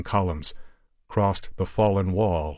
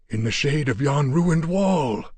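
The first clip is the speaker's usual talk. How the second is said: higher, louder, lightly croaked; croaking Afghan; 7 semitones higher, 4 dB louder than usual. Acted. Yar Ali points out the wall to Steve, croaking. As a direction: rasp it out through blackened lips. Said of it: "he croaked".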